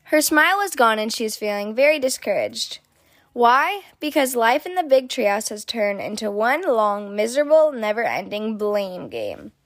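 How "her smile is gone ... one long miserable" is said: plain reading voice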